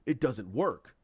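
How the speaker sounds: a brooding villain's flat verdict. Retold or imitated. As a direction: drop the voice low and heavy on it. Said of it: "you can have him say".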